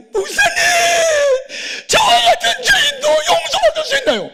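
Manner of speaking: loud, very high hammed-up crying; wailing. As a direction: cry out for forgiveness in an acted wail. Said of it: "무슨 엉엉 우는 거예요". (엉엉 울부짖는 흉내)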